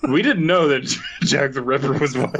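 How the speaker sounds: laughing. Real, not acted. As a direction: keep giggling through the punchline.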